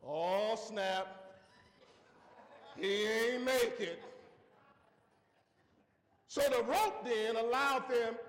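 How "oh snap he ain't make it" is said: lower, slow, deflated realization; dismayed onlookers. Retold or imitated. Men at the rope realizing the priest died, slow and deflated. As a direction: let it sink in slowly. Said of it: "They like".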